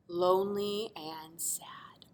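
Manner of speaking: slow sad whisper. Acted. Narrator telling the lonely shape's fate, slow sad whisper. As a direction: let it fall to a whisper.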